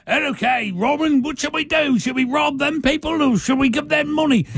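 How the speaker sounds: high, hammed-up band of merry men; eager high-pitched put-on film accent; mock British accent. Film outlaws ask Robin what to do, in a high hammed-up accent. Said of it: "All the people around him are like".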